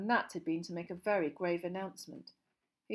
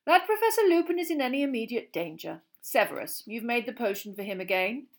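louder, cheerful headmaster interrupting; cheerful raised voice; 4 semitones higher, 8 dB louder than usual. A narrator's reading. Dumbledore ends the teachers' bickering, cheerful and raised. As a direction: cut in brightly and firmly. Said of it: "in a cheerful but slightly raised voice".

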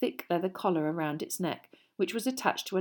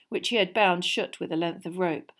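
ordinary delivery, nothing marked

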